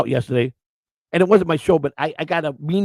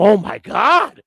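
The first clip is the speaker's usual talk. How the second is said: mock church lady; 9 semitones higher, much slower than usual. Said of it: "you'll see 'em".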